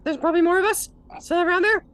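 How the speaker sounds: high squeaky, pained then rambling; hurt kobold. Acted. Kobold spilling secrets after the threat, squeaky.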